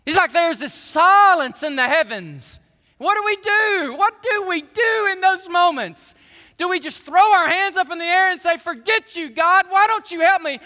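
preaching shout. Acted. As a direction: cry it out to the rafters.

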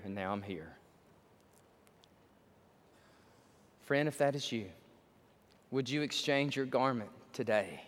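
low, hushed preaching; solemn altar call. Real, not acted. Preacher closing the service with an invitation, solemn and hushed. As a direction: draw them in gravely, softly, with long pauses.

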